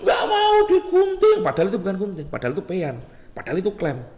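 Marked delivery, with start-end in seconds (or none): 0.1-1.4 very high, drawn-out mock wail; squealed, stretched-out childish refusal; child refusing. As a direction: imitate a frightened kid's protest. Acts out child panicking at scissors.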